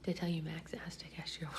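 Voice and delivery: whispering late at night; hushed whisper; quiet at night. Real, not acted. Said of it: "Secret Boyfriend is sleeping".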